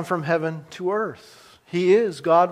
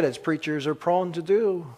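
normal talking voice

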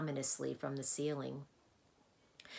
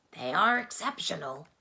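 louder, fond appreciation; admiring Tok; 8 dB louder than usual. A narrator's reading. Tok admires the numbers, fondly. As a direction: savor the numbers.

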